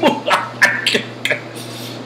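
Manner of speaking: theatrical villain laugh, breaking up voiceless; mock-evil cackle. Teacher announcing the toughies to students, mock-evil cackle. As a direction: cackle wickedly for show.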